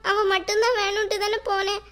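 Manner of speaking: ordinary delivery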